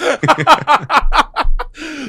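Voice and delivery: a long, high, loud real laugh. Real, not acted. To the host after the accent joke, loud real laugh. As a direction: crack up at your own punchline.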